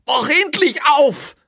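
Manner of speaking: very high, loud, stretched-out shout; frantic yell. A narrator's reading. Prisoner hurling chair at the door, frantic yell. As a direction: scream the demand slowly and desperately.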